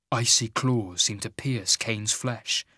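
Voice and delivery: ordinary delivery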